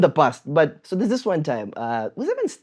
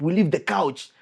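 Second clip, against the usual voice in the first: ordinary talk, nothing marked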